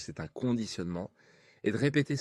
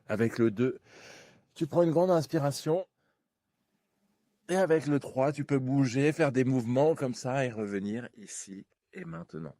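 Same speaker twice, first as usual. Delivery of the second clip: rising, energizing wake-up count; 5 semitones higher than usual. Acted. Counting back out of hypnosis.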